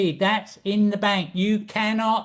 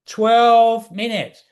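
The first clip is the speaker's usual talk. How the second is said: "Twelve minutes." said slowly and louder, leaning on "Twelve"; emphatic repeat; 6 dB louder, slower than usual. Real, not acted. Teacher stressing to viewers how fast the profit came, emphatic. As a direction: hammer "Twelve" home so it sinks in.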